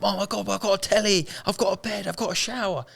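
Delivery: high, excited listing of simple luxuries; overjoyed. Retold or imitated. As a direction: burst out like he's won the lottery. Homeless man overjoyed at hotel room.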